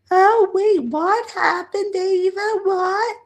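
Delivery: high, confused granny voice; imitating mom. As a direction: play the bewildered grandmother, way up high. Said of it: "I heard my mom say".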